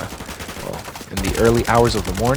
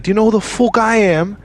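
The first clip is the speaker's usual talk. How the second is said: much higher, louder mock entitled yell; mimicked aggressive outburst; 11 semitones higher, 6 dB louder than usual. Celebrity confronting a security guard, mimicked aggressive outburst. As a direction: mimic a celebrity throwing his weight around. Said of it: "getting really violent and aggressive with me".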